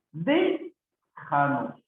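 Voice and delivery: "Déjanos" slow, long "Dé", pause, then fall; broken after first syllable. Shown as a demonstration. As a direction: stretch "Dé" and separate it from the rest. Teacher breaks word up. (separando la primera sílaba, muy lento)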